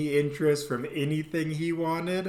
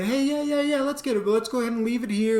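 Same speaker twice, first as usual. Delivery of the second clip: much higher, bright and fast; upbeat agreeing voice; 9 semitones higher, faster than usual. Retold or imitated. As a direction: sell it cheerfully to the girlfriend. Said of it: "So I say".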